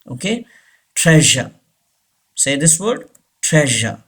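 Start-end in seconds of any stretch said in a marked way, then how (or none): 1.0-1.5 "treasure" ending in "uh", R dropped; British pronunciation. Shown as a demonstration. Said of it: "उस आर को pronounce नहीं करते". 3.4-4.0 "treasure" ending in "uh", R dropped; British pronunciation. Shown as a demonstration. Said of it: "उस आर को pronounce नहीं करते".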